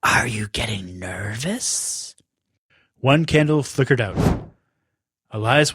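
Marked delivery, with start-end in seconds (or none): 0.0-2.1 soft, slow, low witch taunt; low sly taunt. Acted. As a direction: taunt him quietly and slowly.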